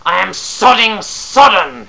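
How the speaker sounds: slow, high, loud furious scream; an outraged howl. Acted. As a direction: bellow it, dripping with rage. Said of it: "he screamed".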